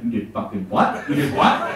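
incredulous, rising to a breathy laughing what; stunned at being added to a list; disbelief. Retold or imitated. Son hearing mom's news, laughing in disbelief. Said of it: "I was like".

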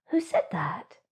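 whispered, wondering hare; hushed question. A narrator's reading. Hare asking the unseen voice, whispering. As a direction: ask into the night in a whisper. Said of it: "he asked".

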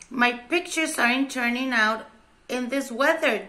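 ordinary delivery

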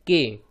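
के as one syllable, k plus long e; blended syllable demo. Shown as a demonstration. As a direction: sound out के clearly for learners. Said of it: "तो बन गया".

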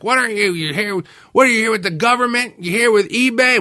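high, loud accusing yell; angry yell. Retold or imitated. Paranoid seller yelling at a photographer. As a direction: storm out and bark accusations. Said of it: "he came storming out of that trailer like".

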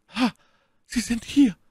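a lightly voiced prisoner, relieved; eager lady. A narrator's reading. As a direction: greet him with sudden eagerness. My Lady greets Felton.

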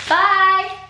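loud high bye across the house; calling out. Real, not acted. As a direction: call goodbye to someone leaving. Dad going to work.

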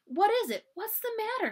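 high, urgent concern; concerned questioning. A narrator's reading. Samantha to crying Nellie on the steps, high and concerned.

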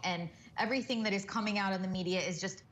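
ordinary delivery

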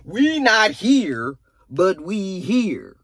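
slow, dragged-out delivery of the quote; mock dramatic quote; quoted line, drawn out. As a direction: stretch every word out.

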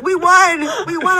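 loud, high victory cry; jubilant fan. Retold or imitated. Guest mimics a stranger celebrating the championship, jubilant. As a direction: imitate an ecstatic fan shouting in celebration.

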